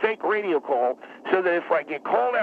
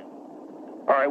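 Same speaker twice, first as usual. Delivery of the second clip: ordinary talk, nothing marked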